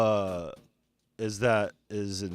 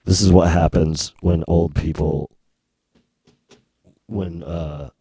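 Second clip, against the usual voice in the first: voice changer; 3 semitones lower, 8 dB louder than usual. Pretend. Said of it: "You're not the only one who has a voice changer".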